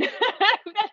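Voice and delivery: high laugh at own joke. Real, not acted.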